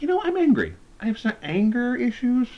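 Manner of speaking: high, hammed-up admission of anger; mock confession. Recapping the show, mimicking him confessing anger issues, hammed-up and high. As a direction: play it as a sheepish high confession.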